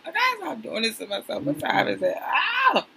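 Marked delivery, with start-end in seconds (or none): 2.2-2.8 sudden high-pitched "ah"; startled cry. Real, not acted. Woman checking what time it is, startled cry. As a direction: let out a short, high yelp.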